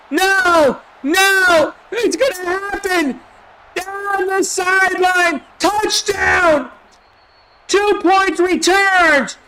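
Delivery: very high, loud, repeated yells; frantic screaming. Real, not acted. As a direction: lose it completely as the play unfolds. Wild return touchdown live.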